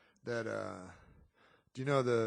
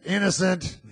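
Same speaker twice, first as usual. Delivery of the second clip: high, loud triumphant verdict; hammed-up jury shouting the verdict; mock verdict shout; 7 semitones higher, 11 dB louder than usual. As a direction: announce it like a jubilant jury foreman. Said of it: "they come back".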